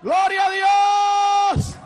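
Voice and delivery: high, loud, long-held cry of praise; exultant praise shout. Real, not acted. Preacher praising God before the congregation, exultant shout. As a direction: shout it out and hold the last word.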